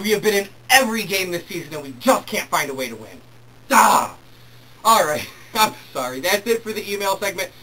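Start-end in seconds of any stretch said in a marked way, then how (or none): 3.7-4.1 loud short "Ah!" of frustration; real frustrated outburst; frustrated shout. Real, not acted. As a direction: let out a fed-up yell. Frustrated cry about losing.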